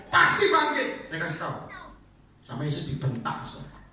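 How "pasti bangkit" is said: much higher, loud insistence; Marta insisting. Retold or imitated. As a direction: insist loudly, high. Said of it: "Marta berkali-kali ngomong".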